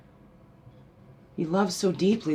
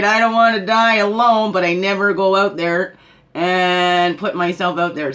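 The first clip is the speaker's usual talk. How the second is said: mock lament; 3 semitones higher, 10 dB louder than usual. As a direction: exaggerate the regret, louder and slowing at the end. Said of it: "doing the opposite like we just talked about".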